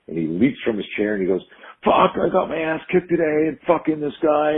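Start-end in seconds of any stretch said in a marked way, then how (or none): 1.8-4.6 high-pitched agitated outburst, not full volume; mock furious yell, pitched well up; retold angry scream. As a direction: act out an upset kid venting at a high pitch. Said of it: "you're screaming".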